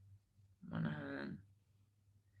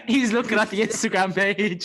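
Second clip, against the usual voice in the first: laughing; 4 semitones higher, 7 dB louder than usual. Real, not acted. Laughing at co-host.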